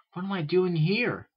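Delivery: high, slow puzzled question; confused filly. A narrator's reading. Filly waking in her own room, high and confused.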